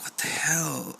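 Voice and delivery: a hushed, breathy "what the hell" under his breath; whispered. Retold or imitated. Corey hearing the spooky story, whispered disbelief. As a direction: whisper it in shock. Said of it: "And he's just like".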